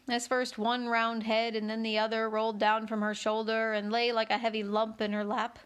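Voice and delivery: ordinary delivery, nothing marked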